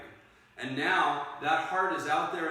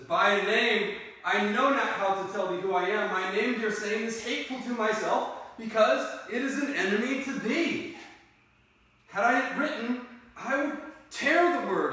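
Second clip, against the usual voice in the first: raised, declamatory lover's voice; earnest Romeo; 4 semitones higher, 4 dB louder than usual. Acted. Romeo renounces his name to Juliet, earnest raised declamation.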